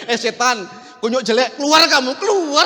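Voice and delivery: mock worn-out demon-chasing, half laughing; spent exorcist. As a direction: command with only scraps of strength left. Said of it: "tinggal sisa-sisa apa saya".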